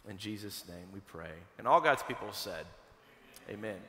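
soft, low prayer voice. Acted. Pastor praying for a grieving family, soft and reverent. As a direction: pray quietly and reverently. Pastor prays over the congregation.

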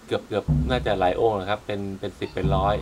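ordinary talk, nothing marked

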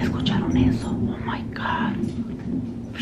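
hushed, unvoiced alarm; awed whisper. Real, not acted. Vlogger startled by thunder asking viewers, awed whisper. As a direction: whisper it, drawing out the last words.

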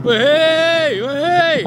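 loud, high hey; excited shout. Real, not acted. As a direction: shout as the car comes. Car approaching, fan shouts.

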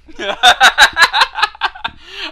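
high, loud cackling laugh; long helpless laugh at full volume; loud incredulous laugh. Real, not acted. Laughing in disbelief at the game.

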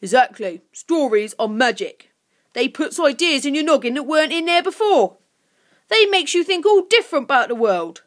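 loud creature rant, rising in pitch; grumpy goblin, forceful and accusing; grumpy bark. A narrator's reading. Creature argues stories are magic, barking grumpily. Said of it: "barked Grunt grumpily".

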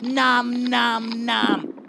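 loud "nom nom" munching; munch happily out loud; happy eating noises. Acted.

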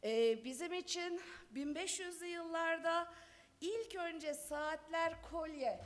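usual speaking voice

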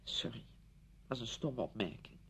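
low, soft apology; hushed and contrite; subdued apology. Acted. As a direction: back down quietly, embarrassed. Apologises after hurting her friend.

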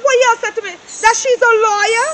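ordinary talk, nothing marked

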